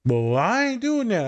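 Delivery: high, slow mocking impression of a defiant student. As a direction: mimic the students' stubborn insistence, drawn out and high. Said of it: "students reply again".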